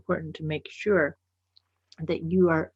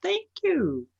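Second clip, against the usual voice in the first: much higher, bright thanks; cheery thank you; 12 semitones higher than usual. Retold or imitated. Echoing the friend's reply with a high, sweet thanks. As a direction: sing it out sweetly.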